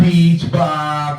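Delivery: tongue held with fingers; holding tongue. Shown as a demonstration. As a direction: say it while holding your tongue. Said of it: "hold your tongue and say".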